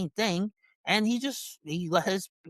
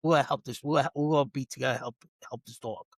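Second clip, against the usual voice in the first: lower, gentle team-player voice; what the husband should have said, lower and softer; calm reassuring; 6 semitones lower, 3 dB quieter than usual. Retold or imitated. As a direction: soothe and offer help. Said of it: "Instead of being like".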